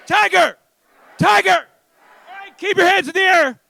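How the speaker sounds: high-pitched calls to the crowd; game commands. Real, not acted. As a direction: fire out each word like a game leader.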